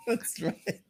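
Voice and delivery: laughing through the words; cracking up as you agree. Real, not acted. Agreeing with his cohost while laughing.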